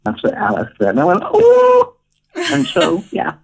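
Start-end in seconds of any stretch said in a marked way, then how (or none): 1.2-1.9 high-pitched, louder "ooh" squeal; swooping up high in excitement; excited ooh. Retold or imitated. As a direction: let out a long high delighted ooh. Squeals at Alice's offer in retelling.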